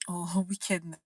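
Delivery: real laugh bursting out around the word; amused, breaking into laughter. Real, not acted. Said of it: "I'm laughing because I remember".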